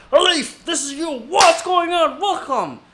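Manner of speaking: high, loud, lion-like boss lady greeting; energetic welcome. Retold or imitated. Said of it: "just energetic ... like a freaking lion".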